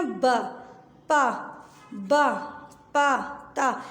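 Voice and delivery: short, separate letter sounds with pauses between them; phonics sounds. Shown as a demonstration. Teacher shows the children how to read the sounds. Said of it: "अब देखिए आपने कैसे पढ़ना है".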